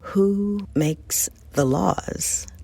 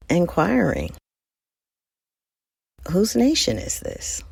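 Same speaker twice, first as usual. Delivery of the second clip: usual speaking voice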